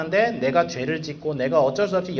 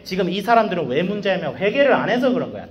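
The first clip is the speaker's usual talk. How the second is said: normal talking voice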